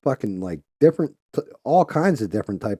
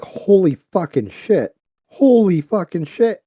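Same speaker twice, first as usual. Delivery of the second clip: slow, building higher and louder disbelief; retold shock; 8 semitones higher, 5 dB louder, slower than usual. Recalling watching the unedited video, stunned disbelief. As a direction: relive the moment the horror hit you.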